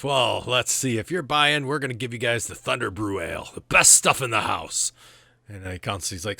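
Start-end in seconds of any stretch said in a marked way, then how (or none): 0.0-4.9 loud, higher-pitched hearty dwarf; dwarf barkeep. Acted. Dwarf barkeep sells Thunder Brew to customers, loud hail. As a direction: greet a kinsman and pitch the house ale. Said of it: "he looks, he says".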